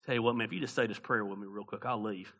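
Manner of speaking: mocking his old door-to-door trick, fast and hushed; sly sales pitch. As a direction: coax him into the quick prayer. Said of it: "my move was".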